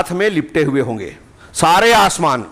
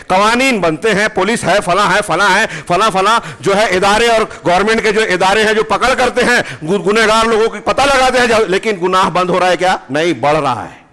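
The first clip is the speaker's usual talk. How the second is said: raised-pitch rhetorical build-up; heated preaching; 5 semitones higher than usual. Acted. Preacher challenging listeners on whether laws stop sin, heated. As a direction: pile up the list toward a challenging question.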